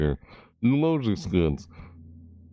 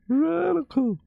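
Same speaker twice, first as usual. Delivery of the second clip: very high surfer squeal. Acted. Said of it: "They sound like they say stuff like".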